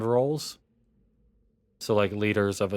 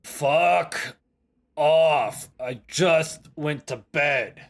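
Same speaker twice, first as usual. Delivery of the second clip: higher-pitched, grumpy sleeper woken up; annoyed, raised pitch, slowing at the end; irritated; 5 semitones higher, 3 dB louder, much slower than usual. Acted. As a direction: tell off whoever woke you, drawn out. Woken NPC answers door knock.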